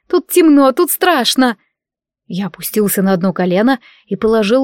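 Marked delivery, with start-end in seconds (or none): 0.1-1.6 put-on scared girl trapped in the dark; frightened, near tears. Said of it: "едва не рыдала Селия".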